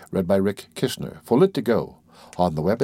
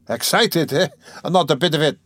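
higher, indignant protest; stung denial; 7 semitones higher, 4 dB louder than usual. Acted. Razumihin denying he is excited, stung to the quick. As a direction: bristle at the teasing. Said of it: "said Razumihin, stung to the quick".

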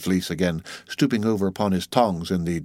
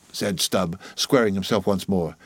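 ordinary delivery, nothing marked

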